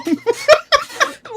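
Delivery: very high, helpless giggle. Real, not acted. Streamer giggling at his own petty plan, high helpless laugh.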